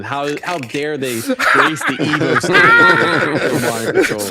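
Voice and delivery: high, loud, drawn-out hammed-up indignation; mock outrage. Pro player imitates snobs offended by modern controls, mock outrage. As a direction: play the scandalized purist, over the top.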